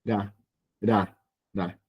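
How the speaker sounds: "Da, da, da" softly, each a single L-like tap; tap, don't roll, each low "Da"; tapped R. Shown as a demonstration. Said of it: "pero golpeándola".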